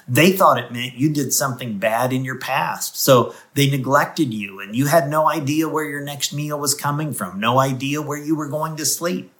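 ordinary talk

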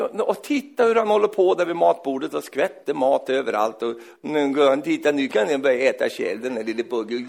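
normal talking voice

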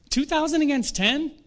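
doubting soldier piping up; voice from the crowd. Acted. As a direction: question the odds, voice lifted high. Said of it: "someone in the crowd says".

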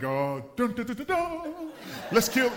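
hammed-up action hero theme hum then a mock battle cry. Pastor mocks the big-guy image with a hammed-up hero charge. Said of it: "I was gonna be like".

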